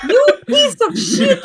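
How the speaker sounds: high, loud, laughing insult. Acted. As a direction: yell it through a laugh. Player snaps at poked prisoner.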